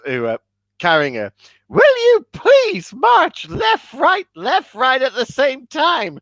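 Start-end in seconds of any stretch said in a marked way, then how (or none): 1.7-6.2 shrill-free high shout, slow on the first words; high shouted orders. Retold or imitated. Madeline Kahn ordering her carriers, high and loud. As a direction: bark orders from high above.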